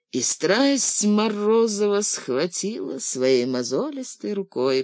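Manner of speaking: ordinary delivery, nothing marked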